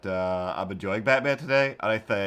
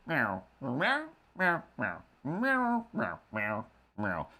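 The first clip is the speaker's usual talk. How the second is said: playful meows, some jumping up high; cat meows; 4 semitones higher, 3 dB quieter, slower than usual. Pretend. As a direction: meow at the cat like a kitten. Imitating cat Ripley.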